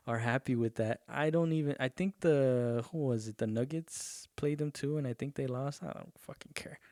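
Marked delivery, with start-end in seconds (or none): 5.8-6.8 whispered under the breath, much softer; muttered aside. Real, not acted. Muttered aside about lost game.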